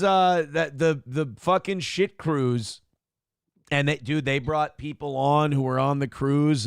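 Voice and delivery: ordinary delivery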